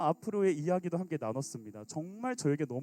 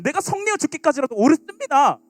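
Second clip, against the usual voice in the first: defiant anger; 9 semitones higher, 12 dB louder than usual. Acted. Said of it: "요나가 이 표현한 거".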